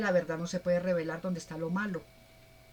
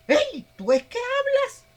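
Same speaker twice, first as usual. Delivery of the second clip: shrill, much higher, loud squeal; frightened shriek; 8 dB louder than usual. Pretend. The worshipper shrieks at the talking idol, loud and high. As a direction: squeal in panic at the statue.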